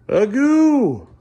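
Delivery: high, loud drawn-out call. Real, not acted. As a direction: holler the nickname playfully high. Calling out to his child.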